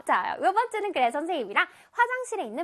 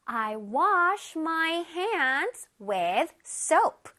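I wash my hands with soap, each word drawn out for the class; very slow model reading; much slower than usual. Shown as a demonstration. Teacher reads the example sentence slowly to the children. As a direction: read it slowly so children can follow. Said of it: "teacher models the example sentence".

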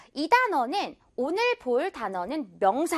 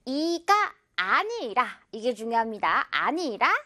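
ordinary talk, nothing marked

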